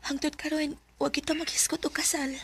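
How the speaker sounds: soft secret whisper. Acted. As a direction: hush it so no one hears. Secret talk about marriage.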